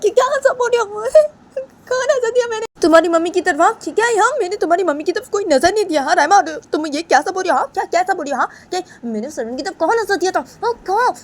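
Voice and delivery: very high, loud tearful protest; crying denial. Acted. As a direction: sob out the denial, pleading. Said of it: "तो बानी वो ऐसे सब बातें बताने लगती है". (बहुत ऊँची रोती हुई सफ़ाई)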